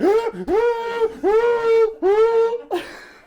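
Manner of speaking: long high gasp, wind knocked out. Retold or imitated. Hit in the sternum by the sheep, winded gasp. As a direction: gasp like you've been hit in the chest.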